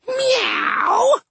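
very high cat yowl; yelled meow. A narrator's reading. Cat goddess smashing demons with her walker, high yowl.

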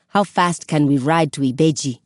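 plain reading voice

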